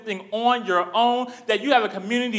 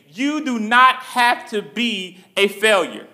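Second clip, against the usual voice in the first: ordinary talk, nothing marked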